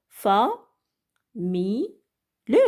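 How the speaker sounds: "Fa, mi, le" split into separate spoken syllables. Shown as a demonstration. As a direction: break "famille" into "Fa", "mi", "le" with pauses between. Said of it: "le découpe en syllabes". (découper "famille" en syllabes: fa, mi, le)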